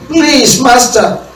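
high pleading plea, rising to a shout; begging servant. Retold or imitated. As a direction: beg the master desperately for time. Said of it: "He begged".

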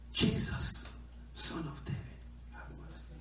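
whispered, hushed plea for mercy; hushed desperate prayer; soft plea. Acted. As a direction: breathe it out softly like a private prayer. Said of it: "trying to pray softly before".

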